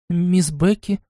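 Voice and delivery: unsure, questioning rise; hesitant. A narrator's reading. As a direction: ask it uncertainly. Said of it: "неуверенно произнесла Лайза". (неуверенно)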